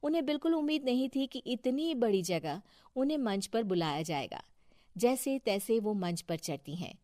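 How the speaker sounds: ordinary delivery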